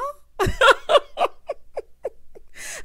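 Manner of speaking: long high cackle; howling laugh; shrieking laugh. Real, not acted. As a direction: scream with laughter. Laughing at Molly.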